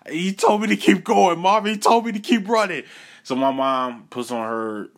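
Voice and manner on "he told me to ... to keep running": frantic pleading. Retold or imitated. Retold frantic plea to mom.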